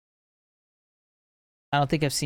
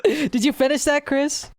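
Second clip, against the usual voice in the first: laughing; 10 semitones higher, slower than usual. Real, not acted. Laughing while asking friend.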